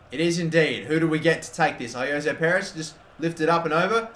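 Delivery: usual speaking voice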